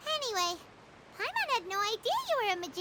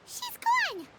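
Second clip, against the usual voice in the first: excited exclaim; 9 semitones higher than usual. Acted. Paimon reacts to Furina vanishing.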